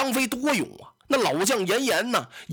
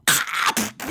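whispered clack and thud of a blade; storyteller imitating a blade striking; sword-chop sound effect; 2 semitones higher than usual. A narrator's reading. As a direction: voice the slash of the sword.

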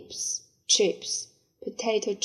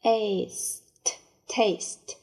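Taste stretched out slowly; slow spelled-out demo; much slower than usual. Shown as a demonstration. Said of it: "taste repeated". (老师慢慢拖长读Taste)

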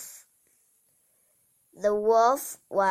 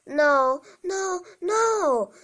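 squeaky little pigs; 9 semitones higher than usual. Acted. Three pigs refuse wolf.

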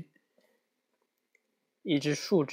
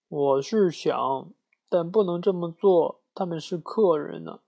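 whimpering; 5 semitones higher, 4 dB louder than usual. Acted. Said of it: "呜咽着说".

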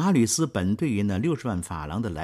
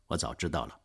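cold and curt, a little softer; coldly; 5 semitones lower, 5 dB quieter, faster than usual. Acted. Marius coldly dismissing the blackmailer, curt and cold. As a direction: brush him off with icy calm. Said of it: "马吕斯冷冷地说着".

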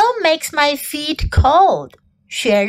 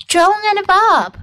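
ordinary delivery, nothing marked

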